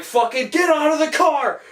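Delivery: high-pitched mock yell; Victor impression. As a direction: imitate a frantic order to bail out of a car. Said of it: "Victor was like".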